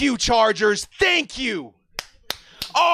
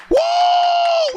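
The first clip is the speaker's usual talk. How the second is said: very high, long "woo"; ecstatic whoop; 4 dB louder than usual. Real, not acted. Fan celebrates his team's pick, ecstatic whooping. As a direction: whoop at the top of your range.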